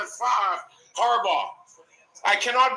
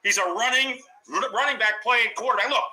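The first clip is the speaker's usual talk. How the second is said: ordinary talk, nothing marked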